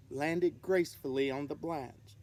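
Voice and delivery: ordinary delivery, nothing marked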